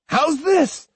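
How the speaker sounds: high defiant shout. A narrator's reading. Boy swinging his sword at a shrub, high shout. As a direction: hurl it out as he swings. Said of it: "shouted Iori".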